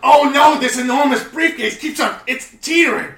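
high, loud laughing panic shout; panicked shouting. Acted. Briefcase tipping out of the trunk, shouting panic. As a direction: yell in rising panic as it tips.

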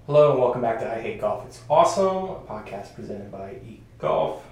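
upbeat, fast announcer read; podcast host intro. Acted. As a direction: sell the show opening, loud.

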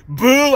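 high imitated boo; drawn-out mock boo; crowd boo. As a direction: jeer like a heckling crowd. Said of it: "getting the shit booed out of me".

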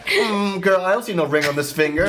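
lightly voiced sassy diva; sassy girl voice. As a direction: sass it out, hand on hip. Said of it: "I can't even do it".

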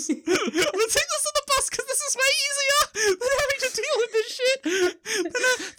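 very high squealing rant through laughter; helpless high-pitched comic outburst; mock hysterics. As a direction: squeak it out, cracking up. Replays murderer's line in mock hysterics.

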